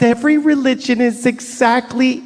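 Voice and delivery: mock preachy tolerance. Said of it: "We love to tell".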